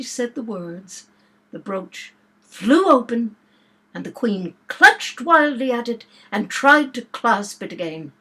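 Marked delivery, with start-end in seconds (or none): none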